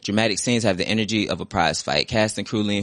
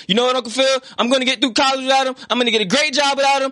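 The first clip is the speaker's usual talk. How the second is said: much higher and louder, defiant and raw; defiant hurt shout; 5 dB louder, faster than usual. Acted. Will vows to Uncle Phil to live without his father, defiant shout. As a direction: spit it out in defiance. Continuing acted outburst.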